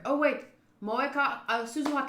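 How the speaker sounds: surprised. Real, not acted. Sudden realisation.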